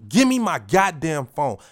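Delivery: loud, slow, hard demand; angry demand to the thief, loud and slow; forceful demand. Retold or imitated. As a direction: bark it out, no messing. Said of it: "That's how you gotta be".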